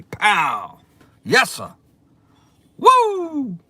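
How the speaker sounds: high, loud celebratory cries; real joyful shouting; excited whoops. Real, not acted. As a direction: pump up the room. Celebrating the donation.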